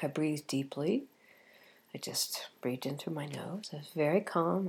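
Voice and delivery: hushed calm. Retold or imitated. Recalling staying calm on plane.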